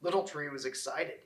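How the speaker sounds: ordinary delivery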